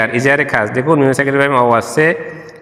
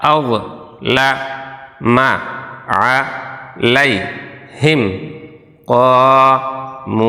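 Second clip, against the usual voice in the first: syllable by syllable. Shown as a demonstration. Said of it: "ভাঙা ভাঙা উচ্চারণ করি".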